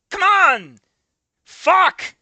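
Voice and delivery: high, loud single curse; frustrated outburst. Real, not acted. Reviewer cursing at the bad prose. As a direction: let it burst out in frustration.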